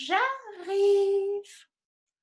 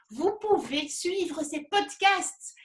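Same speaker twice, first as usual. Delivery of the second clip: ordinary talk, nothing marked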